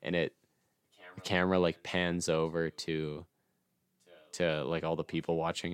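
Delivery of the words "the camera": ordinary delivery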